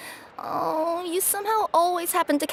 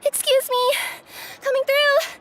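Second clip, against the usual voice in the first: calling out; 9 semitones higher than usual. Acted. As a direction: shout ahead as you push through a crowd. Courier rushing, calling out to crowd.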